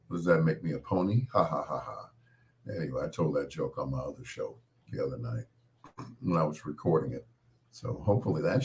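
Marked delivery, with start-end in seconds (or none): none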